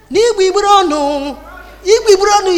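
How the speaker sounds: loud, high mock shout of a passing madman; madman's loud call. Madman calling out in Igbo.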